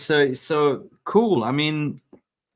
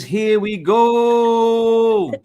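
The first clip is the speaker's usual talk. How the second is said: drawn-out, very slow, higher and louder catchphrase; Fabrizio Romano catchphrase; 8 semitones higher, 8 dB louder, much slower than usual. Retold or imitated. Host imitating the transfer journalist's signature call, very slow and loud. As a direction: stretch every word like the famous transfer call. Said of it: "Fabrizio Romano is using your catchphrase".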